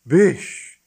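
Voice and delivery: loud, high call; rising, alarmed exclamation; anxious cry. A narrator's reading. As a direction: cry out urgently to a friend. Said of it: "he cried".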